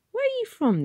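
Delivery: a helpful local man; breezy warden. Acted. Warden to lost Tom, breezy.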